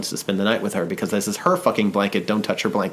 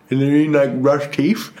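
clueless voice; 4 semitones higher than usual. Retold or imitated. Playing his clueless self.

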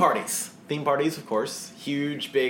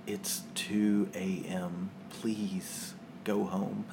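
slow, soft hint; 5 semitones lower, 9 dB quieter, much slower than usual. Retold or imitated. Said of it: "we get the".

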